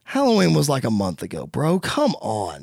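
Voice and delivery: deflated acted disbelief; weary exasperation. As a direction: drop the voice, fed up with a friend. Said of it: "Cody says".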